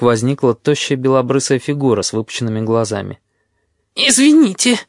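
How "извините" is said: high-pitched plaintive moan; wailed, high-voiced apology. A narrator's reading. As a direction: moan it out, much higher. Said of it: "простонала она".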